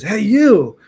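calling out. Retold or imitated. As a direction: imitate a teacher hailing a student. Teacher calling him over.

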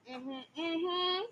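high, soft mimicked mm-hmm; self-important administrator. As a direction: imitate someone acting like the boss. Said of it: "making it sound like he's the".

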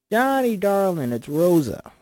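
loud, drawn-out high greeting; salty old-school agent. Acted. Rosa phoning John from her desk, salty old-school voice. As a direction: gush it down the line, big and slow. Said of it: "fifties, old school, salty".

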